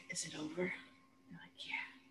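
almost whispered, barely audible exchange; hushed whisper. Retold or imitated. Wounded Peter asks his friends, barely whispered. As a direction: breathe it out weakly, like waking up. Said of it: "And then he's like".

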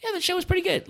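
mock mellow buddy; even-keel friend. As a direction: shrug it off as just fine. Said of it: "And you'd be like".

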